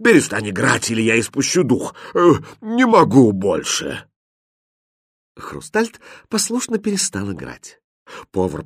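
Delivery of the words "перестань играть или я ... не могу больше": groaned plea of an exhausted cook; groaning. A narrator's reading. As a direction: beg as if about to expire. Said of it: "простонал повар". (со стоном, из последних сил)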